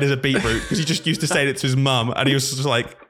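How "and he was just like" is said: ordinary delivery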